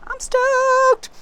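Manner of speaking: very high, loud, drawn-out cheer; exuberant cry. Real, not acted. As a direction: stretch the last word in a high squeal. Exuberant sign-off about the cards.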